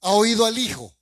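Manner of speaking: usual speaking voice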